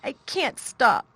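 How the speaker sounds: high, loud, slow sobbing; boy sobbing he cannot stop; gasping sob. A narrator's reading. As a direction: gasp it out through tears. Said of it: "he gasped and sobbed".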